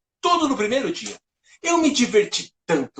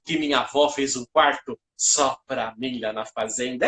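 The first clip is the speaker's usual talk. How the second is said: ordinary talk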